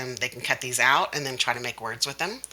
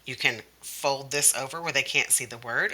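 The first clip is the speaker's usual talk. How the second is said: ordinary talk, nothing marked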